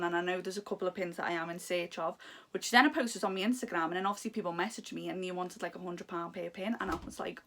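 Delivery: ordinary talk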